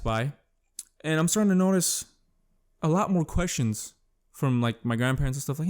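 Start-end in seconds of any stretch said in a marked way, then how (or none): none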